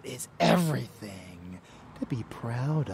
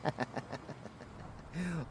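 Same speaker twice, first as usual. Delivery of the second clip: soft, wicked vampire chuckle; sinister chuckle; 6 semitones higher, 7 dB quieter than usual. Acted. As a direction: chuckle darkly to yourself. Vampire amused by savaging prey.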